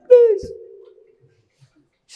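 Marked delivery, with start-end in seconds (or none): 0.1-0.5 high, loud imitation of a tearful plea; mock crying plea. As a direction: mimic a crying woman begging. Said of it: "then she starts crying".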